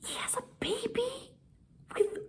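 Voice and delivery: hushed scandalized whisper. Acted. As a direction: whisper the shocking secret. Scandalized plot reveal.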